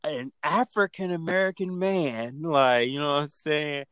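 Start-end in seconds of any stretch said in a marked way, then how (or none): 0.0-2.4 high, put-on panicked caller; mock caller voice. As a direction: lightly voice the frightened caller. Mocking caller to police.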